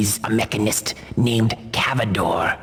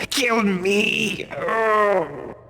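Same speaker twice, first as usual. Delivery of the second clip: high, strained cry to be killed; a tortured spirit begging for release; anguished ghost plea; 9 semitones higher, much slower than usual. Acted. Ghost begs for death.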